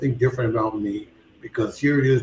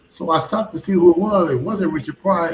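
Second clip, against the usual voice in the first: ordinary talk, nothing marked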